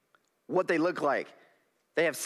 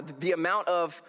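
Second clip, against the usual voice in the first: ordinary talk, nothing marked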